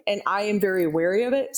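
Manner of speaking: ordinary talk, nothing marked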